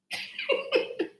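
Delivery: short high-pitched squeal of laughter. Real, not acted. Laughing at viewer's joke.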